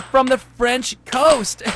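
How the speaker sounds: drawn-out, pleased-with-itself wordplay; hammed-up punchline. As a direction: stretch out the punchline grandly. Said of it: "He said".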